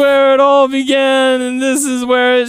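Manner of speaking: high, loud melodramatic declaration; dramatic promo voice. Retold or imitated. Quoting Matt's grand ring speech, high and theatrical. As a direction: proclaim it like a cheesy wrestling villain.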